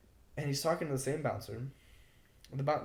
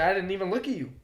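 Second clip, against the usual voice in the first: retold protest, higher and louder; indignant protest; 6 semitones higher, 7 dB louder, faster than usual. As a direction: protest in disbelief, voice pushed up. Retelling his indignant protest to bouncer.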